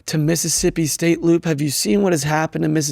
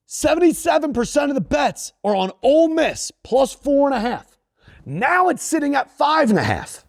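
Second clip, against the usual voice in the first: pitched-up, emphatic betting rant; yelling; 7 semitones higher than usual. Real, not acted. Host telling the people the betting splits, insistent yelling. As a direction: drive the numbers home in a high, insistent voice. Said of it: "Why is he yelling at me?".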